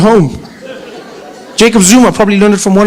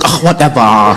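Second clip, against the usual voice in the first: lower, bored "Ah, whatever" brushing it off; dismissive eye-roll; 9 semitones lower than usual. Retold or imitated. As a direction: shrug off the UN with a lazy sigh. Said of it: "Kim Jong-un going".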